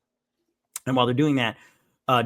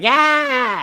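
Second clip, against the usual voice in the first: high, loud whoop of joy; gleeful cheer; 11 semitones higher, 8 dB louder than usual. Retold or imitated. Retelling starving prisoners catching a rat, gleeful cheer. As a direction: cheer like you just caught dinner. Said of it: "so happy".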